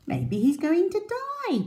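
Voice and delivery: very high chirpy bird voice; chirping bird. A narrator's reading. Bird guessing the crocodile will die, chirping. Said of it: "chirped a bird".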